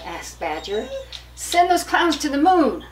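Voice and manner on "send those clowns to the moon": loud high yell to the moon. Acted. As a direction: shout it out, fed up. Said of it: "yelled Chipmunk".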